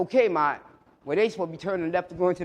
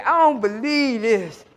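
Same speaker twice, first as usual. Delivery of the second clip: slow, louder, laughing amazement; laughing disbelief; 6 semitones higher, 8 dB louder, slower than usual. Retold or imitated. Son amazed at cop.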